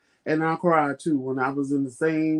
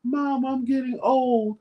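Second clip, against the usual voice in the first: higher, whiny confession to mom; half-laughing plea, slowing at the end; pleading complaint; 7 semitones higher than usual. Retold or imitated. Telling her mom she cries at commercials, higher and whiny. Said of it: "I'm like".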